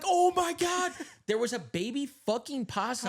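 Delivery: high, loud horrified exclamation; shocked gasp. Retold or imitated. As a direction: gasp at what you see. Seeing the possum.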